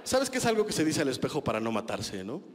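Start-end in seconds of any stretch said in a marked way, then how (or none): none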